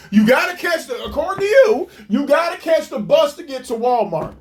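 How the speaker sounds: usual speaking voice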